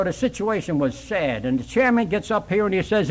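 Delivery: ordinary talk, nothing marked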